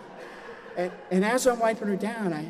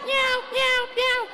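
high, strained cat yowl; cat meowing; slower than usual. Retold or imitated. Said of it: "Cat's like".

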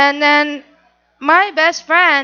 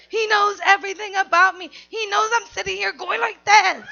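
usual speaking voice

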